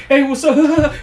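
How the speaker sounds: high, loud, giggly greeting; laughing hell loud. Retold or imitated. Interviewer laughing while interviewing people, mock giggly loud. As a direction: imitate yourself cracking up on a greeting. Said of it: "laughing hell loud".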